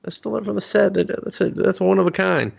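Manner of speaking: high, whiny plea to get the vial back; pleading Peter. Retold or imitated. Peter begs Jerry for his vial in a high whine.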